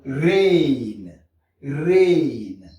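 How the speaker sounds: "rain" with long falling AY and sounded N; English AI plus N. Shown as a demonstration. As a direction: stretch "rain" slowly, English-style. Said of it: "Si c'est A plus N". (« rain » allongé à l'anglaise, N prononcé)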